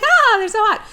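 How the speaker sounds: high gushing fan voice; gushing admirer. Retold or imitated. Girls fawning over hot guys, swooning high voice. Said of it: "they're like".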